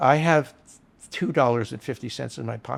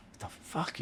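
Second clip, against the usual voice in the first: breathy whisper of disbelief; whispered aside; 4 semitones higher, 15 dB quieter than usual. Retold or imitated. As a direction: whisper it under your breath. Owner reacting from across the bar.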